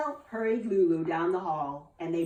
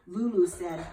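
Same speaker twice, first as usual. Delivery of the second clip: plain reading voice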